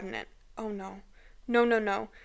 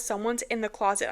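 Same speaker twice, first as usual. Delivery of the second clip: usual speaking voice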